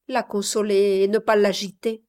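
ordinary delivery

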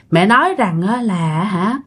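ordinary delivery, nothing marked

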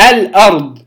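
الأرض with the ل clearly sounded before the hamza; إظهار اللام. Shown as a demonstration. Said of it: "أظهرت اللام الساكنة". (الأرض بإظهار اللام الساكنة)

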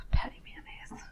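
unvoiced whispered name after a sigh. Sighs, then whispers the name.

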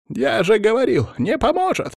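very high, distressed outcry; panicked cry. A narrator's reading. Pale cursed man reproaching the guards, panicked cry. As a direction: cry out shrilly, panicked at being ignored. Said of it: "вскрикнул усатый бледный мужчина".

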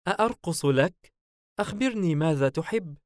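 high, eager-to-please female voice; smiling. A narrator's reading. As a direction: offer playfully with a smile. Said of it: "سعلوة باسمة". (عرض باسم بنبرة عالية)

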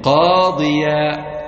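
قاضيا very slow, ending on a long alif; stretched alif ending. Shown as a demonstration. Teacher stretching قاضيا for the class. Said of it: "نحو".

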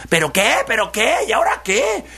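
high, rising impatient questions; exasperated fox. Acted. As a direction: fire back in exasperation, pitch climbing each time. Fox exasperated by piglet's stalling. (zorro exasperado, cada vez más agudo)

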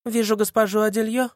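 put-on high girlish voice. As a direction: play a sly teenage girl. Sasha's girlish voice.

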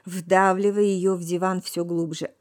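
plain reading voice

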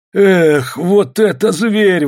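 loud, high, drawn-out marvel; amazed exclamation. A narrator's reading. Onlooker seeing the huge bear, amazed exclamation. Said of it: "воскликнул кто-то".

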